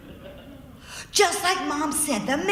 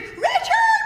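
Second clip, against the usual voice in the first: very high, loud shriek of his name; scream; 6 dB louder than usual. Retold or imitated. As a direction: yell the name in mock terror. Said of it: "we were screaming".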